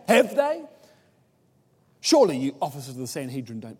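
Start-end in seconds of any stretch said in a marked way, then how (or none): 0.1-0.6 scornful taunt. Retold or imitated. Rhetorical taunt repeated.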